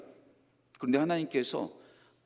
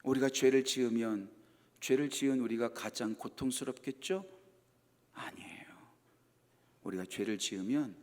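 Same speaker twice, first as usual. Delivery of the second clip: soft, low, near-whispered sermon; hushed preaching; 7 semitones lower, 9 dB quieter than usual. Acted. Preacher softly telling of God's sorrow, hushed. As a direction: draw them close with a hush.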